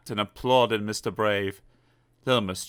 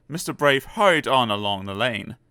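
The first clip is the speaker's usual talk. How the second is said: ordinary delivery, nothing marked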